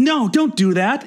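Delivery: mock protest from a voice inside the head; inner voice. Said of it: "the voice inside her head".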